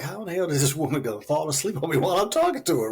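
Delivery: rushed, mock-outraged retelling; retold anger. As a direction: replay your indignant disbelief at being ignored. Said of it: "I remember getting angry".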